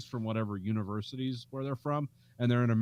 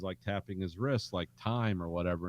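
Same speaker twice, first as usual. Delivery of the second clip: usual speaking voice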